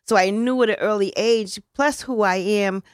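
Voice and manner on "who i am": normal talking voice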